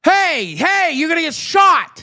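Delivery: high-pitched put-on voice; hammed-up high street character; street warning. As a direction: warn a would-be mugger in mock alarm. Acted street encounter in New York.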